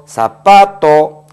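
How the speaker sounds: slow, loud 사파토 with weight on 파; drawn-out teacher demo of 사파토; stress on 파. Shown as a demonstration. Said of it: "끝에서 이와 같이 하나, 두 번째". (파 에 강세 주며 천천히 사파토)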